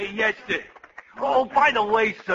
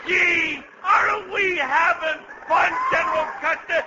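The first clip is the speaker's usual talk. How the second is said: gleeful shout; 6 semitones higher than usual. Acted. Aide shouts happily to General Custer in battle. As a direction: cheer like it's a party.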